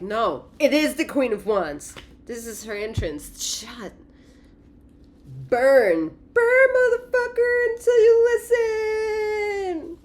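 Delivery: high, loud, slow commanding cry; fierce shout. Acted. The cards insisting on Queen of Wands, high shout. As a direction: roar it like a fiery queen. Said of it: "they're like".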